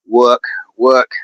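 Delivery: practising "work" in an Australian accent; careful, isolated Australian "work". Shown as a demonstration. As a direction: try "work" the Aussie way, r dropped. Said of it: "get more into that accent".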